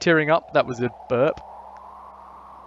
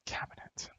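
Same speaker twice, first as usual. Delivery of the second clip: whisper; 19 dB quieter than usual. Real, not acted. Reads game prompt under breath.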